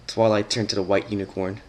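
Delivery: ordinary delivery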